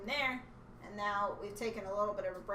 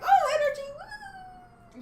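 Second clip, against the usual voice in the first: squealing high mock excitement; hammed-up burst of energy; fake energy; 10 dB louder, slower than usual. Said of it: "so now we're like".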